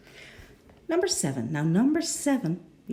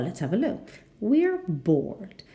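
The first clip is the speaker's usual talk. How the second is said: normal talking voice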